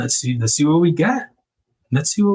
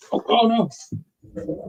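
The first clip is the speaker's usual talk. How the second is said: sudden high yelp of dismay; surprised oh no; 8 semitones higher, 3 dB quieter than usual. Real, not acted. Fumbling a sticker while packing, a startled oh no. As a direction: caught off guard, voice jumps up.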